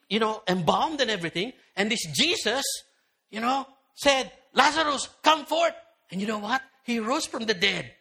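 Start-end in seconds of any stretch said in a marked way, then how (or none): 4.5-5.7 higher, louder call into the tomb; commanding call. Retold or imitated. Jesus calling dead Lazarus out of the grave, loud and commanding. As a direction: summon him out of the grave.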